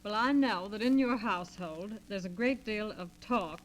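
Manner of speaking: ordinary talk, nothing marked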